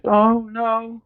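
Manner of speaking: high, small frightened oh no; scared gasp. Retold or imitated. Woman noticing the open window, scared. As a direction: breathe it in fear. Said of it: "she's just like".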